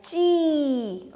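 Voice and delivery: ची with the ee sustained; long vowel sound. Shown as a demonstration. Teacher demonstrates the long sound of the sign, ची held long. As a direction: hold ची long on the ee. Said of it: "इसका लंबा sound आता है".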